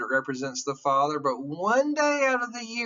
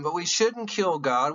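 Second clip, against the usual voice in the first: ordinary delivery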